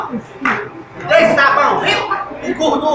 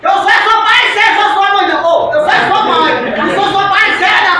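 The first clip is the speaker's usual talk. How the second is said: higher and louder, outraged mum; loud indignant mother; 5 semitones higher, 5 dB louder than usual. Acted. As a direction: insist on being the parent, loud. Mother asserting authority loudly.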